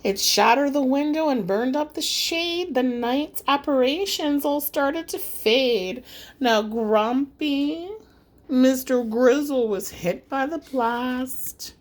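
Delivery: ordinary delivery, nothing marked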